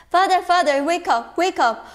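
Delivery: frantic son waking father; urgent calling. Acted. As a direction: shake him awake with your voice.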